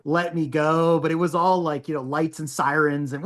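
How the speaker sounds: ordinary talk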